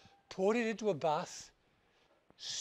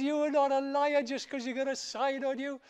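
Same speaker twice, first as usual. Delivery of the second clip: lightly voiced, higher mocking friend; 7 semitones higher, 6 dB louder than usual. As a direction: scoff at him, a little higher. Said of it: "He started to laugh".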